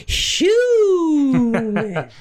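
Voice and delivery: high, drawn-out whoop sliding down; running-joke holler. Pretend. Host dropping the show's joke name into his story, high holler. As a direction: stretch the word into a high holler.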